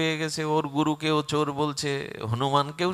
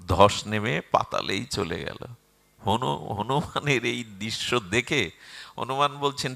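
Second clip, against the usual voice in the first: normal talking voice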